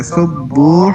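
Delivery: سبوح with the بو drawn out long; long bo in sabbuh. Shown as a demonstration. Teacher showing the student a long بو in سبوح. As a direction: stretch the بو in سبوح. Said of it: "بو کو لمبا کرنا ہے۔".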